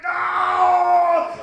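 long, high, loud cry; retold scream. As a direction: draw out a high yell. Retold cry, running from enraged stepfather.

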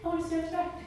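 put-on fast, eager little-kid voice; a kid blurting yes to grandpa. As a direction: rush it out like an excited eight-year-old. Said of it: "look at my brother".

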